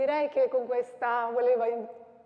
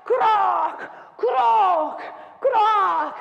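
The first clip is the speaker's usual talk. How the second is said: louder, higher croc on each step; shouted marching beats; 9 semitones higher, 9 dB louder, much slower than usual. Acted. Voicing the marching battalions, loud. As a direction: stamp out every croc.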